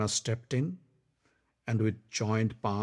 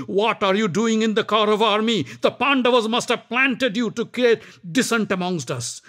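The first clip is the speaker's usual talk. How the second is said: higher, louder, fast rant; furious; 7 semitones higher, 4 dB louder than usual. Acted. Karna raging at Shalya, furious. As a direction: lash out in anger. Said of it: "Karna was furious".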